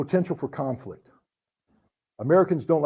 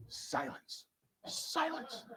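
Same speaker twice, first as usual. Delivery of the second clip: whispered silence; 9 semitones higher, 19 dB quieter, much slower than usual. Retold or imitated. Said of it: "dramatic pause".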